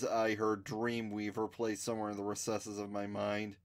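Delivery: plain reading voice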